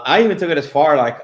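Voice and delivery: ordinary talk, nothing marked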